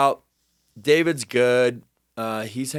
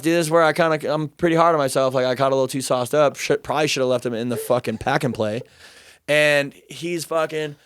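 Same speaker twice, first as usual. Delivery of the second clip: ordinary talk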